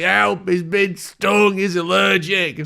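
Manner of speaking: high-pitched, drawn-out call for help; high, slow panic. Acted. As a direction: wail for help in falsetto. Calling for help.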